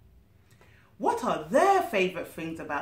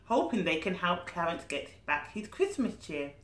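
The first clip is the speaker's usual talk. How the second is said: ordinary delivery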